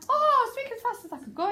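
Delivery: high-pitched gabbling character voice; really fast. Shown as a demonstration. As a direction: rattle it out as fast as possible. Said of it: "He talks like that".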